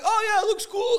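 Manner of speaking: very high, loud mock enthusiasm; clueless idiot. Homeowner approving a bad paint job, like an idiot. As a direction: gush like a clueless fool. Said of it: "Like a fucking idiot".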